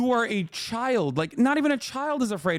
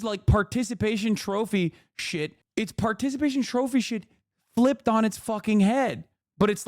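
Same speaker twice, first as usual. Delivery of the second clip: ordinary talk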